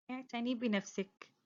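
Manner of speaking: ordinary talk, nothing marked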